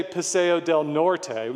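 "Norte" ending in a Spanish "tay"; "Norte" the Spanish way, final e sounded "ay"; Spanish pronunciation. Shown as a demonstration. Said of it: "saying it like the Spanish".

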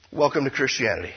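ordinary talk, nothing marked

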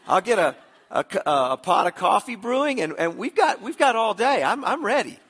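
high, loud, eager outburst; wife's eager disbelief. Retold or imitated. Wife hears husband offer to confess his sins, loud eager disbelief. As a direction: play an incredulous wife jumping at the offer, pitch and volume up. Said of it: "She says".

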